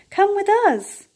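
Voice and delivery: high, bright come with me; eager invitation. A narrator's reading. Little beaver happily inviting the duck along. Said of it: "said Little Beaver and the duck".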